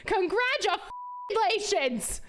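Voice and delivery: slow, high sarcastic congratulations. Pretend. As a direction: drag it out mockingly. Sarcastic concession to viewers.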